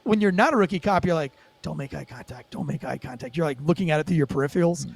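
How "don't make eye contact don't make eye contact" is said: low, very soft self-talk; hushed self-reminder. Acted. Cop reminding himself not to look at driver, hushed. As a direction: say it low, under your breath. Seasoned cop's inner voice avoiding a glance.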